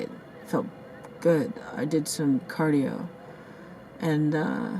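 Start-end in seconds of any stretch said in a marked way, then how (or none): 0.0-1.5 whispered. Real, not acted. Whispers the repeat after plain take.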